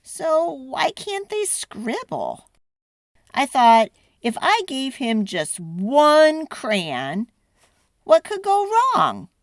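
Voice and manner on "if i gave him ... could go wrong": ordinary delivery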